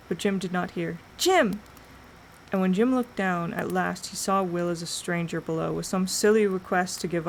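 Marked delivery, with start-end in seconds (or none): 1.2-1.6 a sharp high call. A narrator's reading. Will calls up to Jim again, sharp and high. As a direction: call his name once more, louder.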